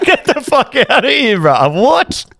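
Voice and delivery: high, slow incredulous outburst; mock disbelief. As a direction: squeal it in disbelief, stretched out. Retelling own shocked reaction.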